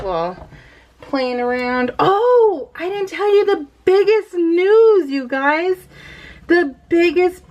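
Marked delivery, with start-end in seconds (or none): none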